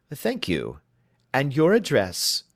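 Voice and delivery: lightly voiced, crisp questioning detective; brisk detective. Holmes questioning his client, crisply. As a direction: probe her story, brisk and in command.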